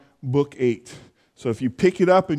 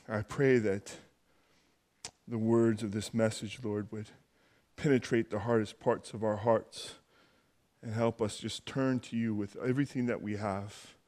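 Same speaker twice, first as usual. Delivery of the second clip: soft, low praying voice; opening prayer; 5 semitones lower, 10 dB quieter than usual. Real, not acted. Pastor praying to the Heavenly Father before the message, hushed prayer. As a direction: pray quietly and humbly, with pauses.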